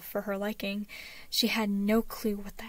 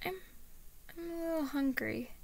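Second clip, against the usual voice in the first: put-on shy, high child voice; hesitant small-boy admission; little boy; 6 semitones higher, 4 dB quieter than usual. A narrator's reading. Boy shyly admits hunger.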